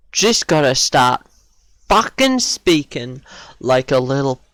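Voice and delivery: loud, halting frustrated rant; angry self-berating. Real, not acted. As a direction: punch each insult at yourself, with pauses between. Berating self after dying in game.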